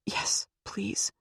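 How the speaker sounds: whispered through welling tears; barely voiced, holding back tears; tearful whisper. A narrator's reading. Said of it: "and her eyes welled".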